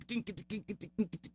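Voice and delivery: soft, tapping merengue rhythm; merengue beat imitation. Shown as a demonstration. Host imitating nineties merengue, soft tapped beat. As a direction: tap out the merengue beat with your voice. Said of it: "el merengue".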